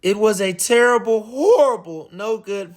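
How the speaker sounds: ordinary delivery, nothing marked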